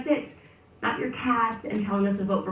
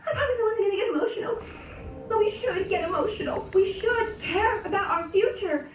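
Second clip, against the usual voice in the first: mock crying; 14 semitones higher than usual. Mocking J Lo's tearful line.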